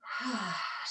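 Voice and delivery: long, soft breath out; soft exhaling sigh; audible sigh. Real, not acted. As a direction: let out a relaxed sigh, falling away softly. Models a breath for the class.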